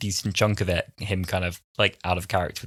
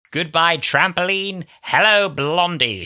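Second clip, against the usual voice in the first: higher, louder, slow and sneering; mocking farewell; 8 semitones higher, 6 dB louder, slower than usual. Cassandra in Rose's body mocking herself, slow and higher. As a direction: savour the insult slowly. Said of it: "When she becomes Rose".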